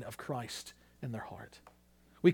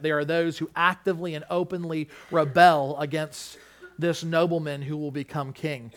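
ordinary talk, nothing marked

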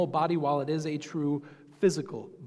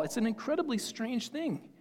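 ordinary talk

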